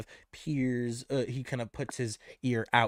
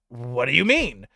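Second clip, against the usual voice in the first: puzzled question, a touch louder; confused; 6 semitones higher, 8 dB louder than usual. Acted. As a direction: sound thrown off. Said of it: "Very confused, he asks Nicholas".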